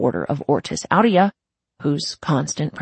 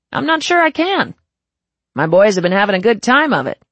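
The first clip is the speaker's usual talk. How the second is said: smug gang boss; 4 semitones higher, 6 dB louder than usual. Acted.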